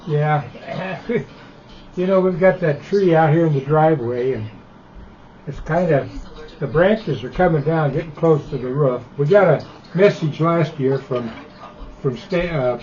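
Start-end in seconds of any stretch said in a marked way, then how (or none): none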